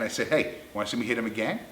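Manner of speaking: ordinary talk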